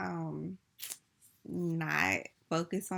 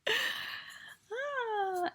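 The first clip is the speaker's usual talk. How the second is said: sleepy, yawning through words; 11 semitones higher, much slower than usual. Real, not acted. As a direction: talk through a yawn. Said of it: "yawning and I'm sleepy".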